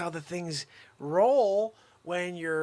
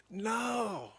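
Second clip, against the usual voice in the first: usual speaking voice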